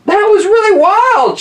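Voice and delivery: put-on high, loud thrilled girl; excited exclaim. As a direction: squeal it like the ride was the best ever. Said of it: "she exclaimed".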